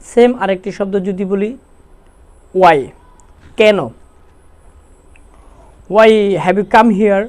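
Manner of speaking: ordinary delivery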